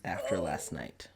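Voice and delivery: low, tender, subdued reply; quiet acceptance. Acted. Dimitri shyly accepts a hug.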